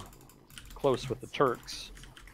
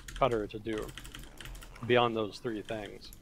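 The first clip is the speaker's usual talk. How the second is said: ordinary talk, nothing marked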